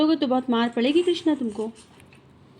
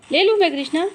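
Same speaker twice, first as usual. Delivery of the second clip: playful teasing; 6 semitones higher, 5 dB louder, faster than usual. Real, not acted. Teasing parrot over jaggery.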